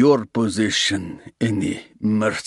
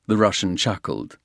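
ordinary talk, nothing marked

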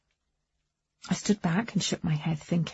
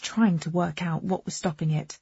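ordinary delivery, nothing marked

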